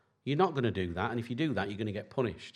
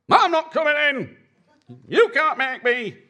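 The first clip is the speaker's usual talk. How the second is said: put-on much higher, louder voice of a defiant five-year-old; stroppy little girl; 9 dB louder than usual. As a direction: stamp your foot like a sulking child. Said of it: "She had a little paddy".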